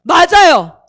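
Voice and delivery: loud, projected imitation of a preacher; acted pulpit outburst; pastor's emphatic agreement. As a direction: declare it to the whole congregation. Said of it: "목사님이 그 순간 저를 향해서".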